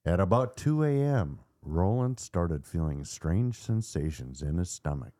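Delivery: ordinary delivery, nothing marked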